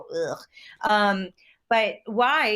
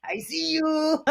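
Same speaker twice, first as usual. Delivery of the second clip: high, slow playful tease; teasing; 8 semitones higher, slower than usual. Retold or imitated. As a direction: tease her approvingly, drawn out. Continuing the Mexican tease.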